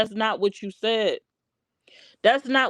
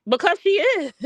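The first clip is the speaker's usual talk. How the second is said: her words mimicked high and a touch louder; mocking quote; 8 semitones higher, 3 dB louder than usual. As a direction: toss her line back in a raised mock voice. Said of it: "She was like".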